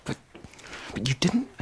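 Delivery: small stunned whisper. Real, not acted. Boy protesting to the adults, whispering. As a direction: breathe it out, barely voiced.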